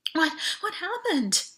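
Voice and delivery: high, slow, trembling question; shaky, frightened. Acted. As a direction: let the voice shake with fear. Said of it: "Her voice shook".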